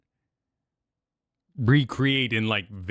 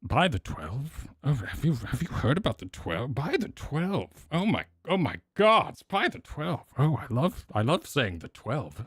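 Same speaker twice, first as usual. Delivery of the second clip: mock fanatic players; 5 semitones higher than usual. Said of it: "everyone so, all of a sudden was like".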